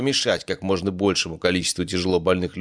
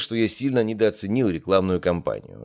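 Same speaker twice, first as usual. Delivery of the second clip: usual speaking voice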